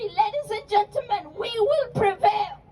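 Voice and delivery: ordinary talk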